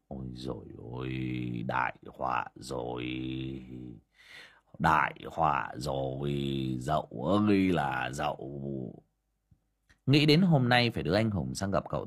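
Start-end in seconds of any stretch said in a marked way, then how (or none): 0.1-9.0 much lower, much softer, stunned murmur; dazed muttering. A narrator's reading. Uncle Vó murmuring in shock about Mrs Dậu's disappearance, low and soft. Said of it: "đờ đẫn cả người mà lẩm bẩm".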